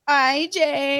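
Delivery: high sing-song sitcom wife impression; Sofia Vergara. Co-host mimicking Modern Family's wife, high accented voice. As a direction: greet him high and drawn out. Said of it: "I also sound like Sofia Vergara's".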